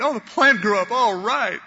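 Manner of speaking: high-pitched hammed-up delight; mock excitement. Jonah delighted that his plant grew up, acted excitement. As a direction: cheer it like an overjoyed Jonah. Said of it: "he's so excited because of this plant".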